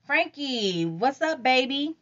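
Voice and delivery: high sweet flirty greeting. Acted. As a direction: coo it like a tease. Lauren flirts on phone.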